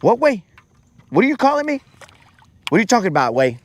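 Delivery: high-pitched, baffled brother impression; imitating his brother. Brother reacting to being called Abu, high imitated voice. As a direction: squeak it up, indignantly questioning. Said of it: "And then he'll be like".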